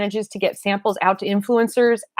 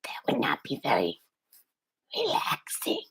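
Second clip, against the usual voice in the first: grotesque whispered monster voice; breathy creature rasping an ASMR whisper; Iris monster voice; 5 semitones higher, 10 dB quieter than usual. Pretend. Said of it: "It hurt me just to do that little bit of Iris's voice".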